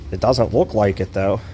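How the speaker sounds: ordinary talk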